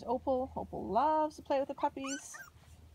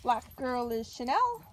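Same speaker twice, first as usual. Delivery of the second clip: normal talking voice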